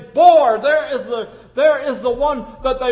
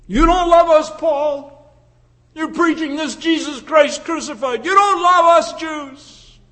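accusing shout; 7 semitones higher, 6 dB louder than usual. Retold or imitated.

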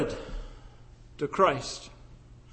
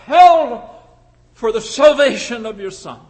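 loud, stark declaration; 2 semitones higher, 8 dB louder than usual. Retold or imitated. Imagined demand on parents.

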